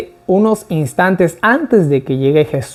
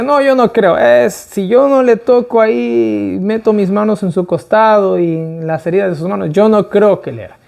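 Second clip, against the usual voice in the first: higher-pitched acted protest; Tomás's stubborn doubt; 5 semitones higher than usual. Doubting apostle refusing to believe without touching, acted protest. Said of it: "Y Tomás decía".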